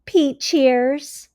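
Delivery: bright, expressive storybook read-aloud; reading to children. A narrator's reading. Teacher reading a story to her class, expressive read-aloud. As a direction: read it like a teacher sharing a picture book.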